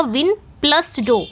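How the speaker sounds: "विन", then "डो", slowly separated by plus; split into syllables. Shown as a demonstration. Teacher splitting window for students, slow syllable breakdown. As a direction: break window into विन and डो, taking time on each. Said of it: "इसमें टू syllable हैं".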